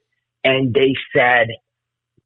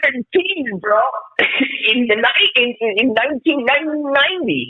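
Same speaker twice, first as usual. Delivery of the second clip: high, laughing through the words; laughing in amazement; laughing disbelief; 12 semitones higher than usual. Real, not acted. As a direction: giggle while you recall it. Laughing at his luck.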